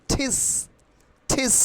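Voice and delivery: ঠিস with a puffed T, word set apart; aspirated T. Shown as a demonstration. Teacher drilling students on the word teach, aspirated T. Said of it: "টিচের উচ্চারণ কিভাবে করব".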